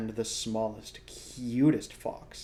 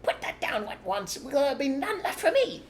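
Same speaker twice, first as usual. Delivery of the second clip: higher, louder scolding rat; shrill rat; 6 dB louder than usual. A narrator's reading. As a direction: scold them like a greedy rat.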